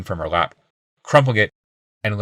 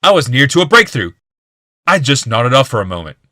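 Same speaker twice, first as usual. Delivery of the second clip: insistent; 5 semitones higher, 7 dB louder than usual. A narrator's reading. Old man angrily insisting.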